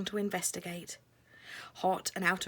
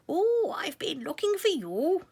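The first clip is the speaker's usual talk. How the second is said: put-on high breathy old lady; old woman voice; 9 semitones higher, 6 dB louder than usual. A narrator's reading. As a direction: whisper it as an old mouse woman. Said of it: "she said".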